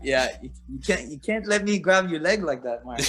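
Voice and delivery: high, imitated French accent, dry and deadpan; George Saint Pierre French accent. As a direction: do GSP in a French accent, teasing. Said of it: "with his French accent".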